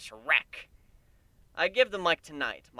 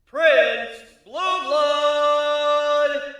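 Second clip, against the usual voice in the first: high, loud emcee voice, stretching the name slowly; show host announcement; 12 semitones higher, 9 dB louder, much slower than usual. Acted. Emcee announcing the next singer to the crowd, high and loud.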